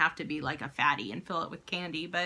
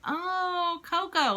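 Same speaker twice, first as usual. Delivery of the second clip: high, drawn-out sudden understanding; dawning realization; 10 semitones higher, much slower than usual. Retold or imitated. Mum spotting the statue her son meant, dawning realization. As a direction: let the penny drop, pitched high.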